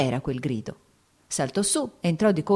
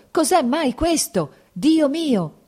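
desperate wail; 9 semitones higher, 6 dB louder, slower than usual. A narrator's reading. Lievin panicking, grabbing doctor.

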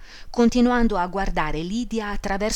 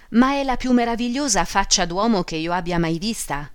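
usual speaking voice

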